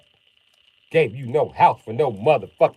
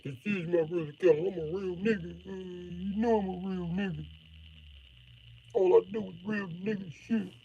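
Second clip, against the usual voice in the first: higher, laid-back imitation of a rapper; mock rapper voice; 5 semitones higher, 11 dB quieter than usual. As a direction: play a wannabe rapper bragging. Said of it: "be like Empire Esports and be like".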